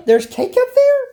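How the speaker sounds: squeaky, high delighted question; high and amazed at the cake; excited gasp. Acted. Friend gasps at cake up in the tree, squealing.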